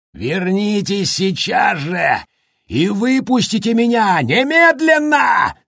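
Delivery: high, slow furious shout; berserk rage. A narrator's reading. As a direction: roar like a trapped madman. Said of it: "заорал Джек".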